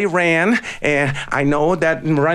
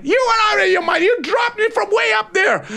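much higher, fast indignant yell; outraged chicken. A narrator's reading. Chicken yelling at the eagle for dropping it, outraged. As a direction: scold the eagle in a fright.

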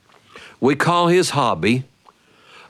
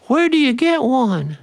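put-on much higher, innocent child voice; curious little boy; 12 semitones higher than usual. Little brother asking sister, high innocent child voice. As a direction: ask with wide-eyed innocence.

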